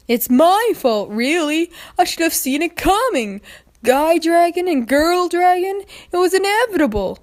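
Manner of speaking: put-on a high young dragon, resigned and loud; resigned Spike. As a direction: shrug it off as if it was bound to happen. Spike shrugging it off.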